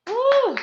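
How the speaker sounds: high, loud end-of-set whoop; whooped "woo". Real, not acted. Coach resting after the last lunge, loud high whoop.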